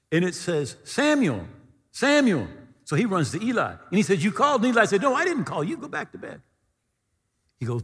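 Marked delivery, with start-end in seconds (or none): 0.8-2.5 high, loud call of a name; ringing, raised summons. Acted. Voice calls the boy at night. 4.2-4.6 high, loud, eager answer; boy answering a call; eager question. Acted. Boy runs to the priest. 5.0-6.4 high-pitched protest from a woken priest; exasperated, high-voiced brush-off; dismissive denial. Woken priest sends boy back.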